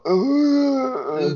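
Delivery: high, drawn-out zombie moan. Retold or imitated. Film fan mimics characters pretending to be zombies, zombie moan. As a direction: imitate a groaning zombie. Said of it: "to do the zombie voice".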